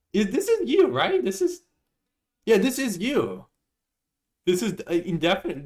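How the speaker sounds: gleeful gotcha; teasing disbelief. Real, not acted. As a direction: squeal at them in giddy disbelief. Teasing accusation at chat message.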